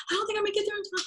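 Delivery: very high panicked wail; high frantic worry. Retold or imitated. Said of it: "I go".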